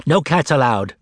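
plain reading voice

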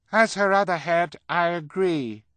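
very high, loud character voice; put-on high voice. A narrator's reading. Klaus in fake high voice.

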